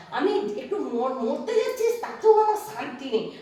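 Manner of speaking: high, loud anguished cry; anguished wail. Acted. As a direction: wail that she can't even die in peace. Mother wails about dying.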